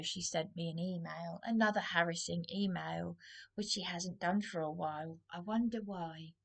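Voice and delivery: ordinary delivery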